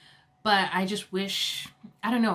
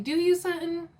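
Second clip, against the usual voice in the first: sarcastic, pitched way up; sassy mock interrogation, pitched high; mock challenge; 8 semitones higher than usual. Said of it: "And I'm like".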